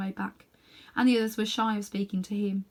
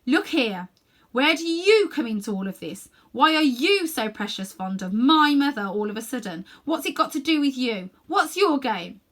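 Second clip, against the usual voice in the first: loud, firm challenge; much louder; 4 semitones higher, 7 dB louder than usual. A narrator's reading. Diggory confronting the witch, loud and firm. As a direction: stand up and challenge her. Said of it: "in a different and much louder voice".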